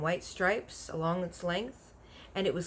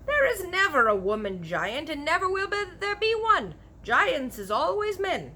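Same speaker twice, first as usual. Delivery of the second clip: insistent giant; 10 semitones higher, 5 dB louder than usual. Acted.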